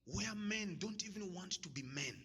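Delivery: normal talking voice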